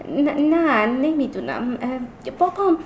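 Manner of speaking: halting, searching for words; hesitant stammer. Acted. Child unable to explain to classmates, stammering. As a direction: falter and break off between words. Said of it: "منمن کردم".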